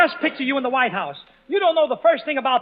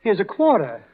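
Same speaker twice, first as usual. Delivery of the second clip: low fatherly voice; a warm indulgent father; 5 semitones lower than usual. Acted. Plays the father.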